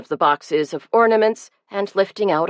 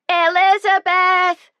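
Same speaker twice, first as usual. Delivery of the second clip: high, loud, drawn-out shriek across the house; 12 semitones higher, 4 dB louder, slower than usual. A narrator's reading. Sarah calling her sister to the phone, high shriek. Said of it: "she shrieked".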